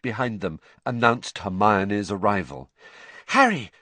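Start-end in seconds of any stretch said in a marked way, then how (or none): 3.3-3.7 breathless cry of Harry; out of breath from running, higher; panting. Acted. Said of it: "she panted".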